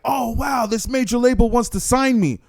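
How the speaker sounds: high-pitched wowed newcomer; lightly acted starry-eyed wonder; mock amazement. Voicing a flattered new artist.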